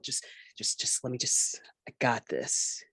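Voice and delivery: soft unvoiced desperate whisper; a hushed last plea for credit; whispered pleading. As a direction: beg under your breath. Pac-Man pleading, trailing off.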